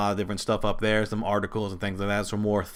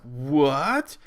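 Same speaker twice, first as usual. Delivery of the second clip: puzzled, rising what; baffled fan asking where this goes; confused surprise; 5 semitones higher, slower than usual. Retold or imitated. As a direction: caught off guard, voice climbing. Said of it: "I was like".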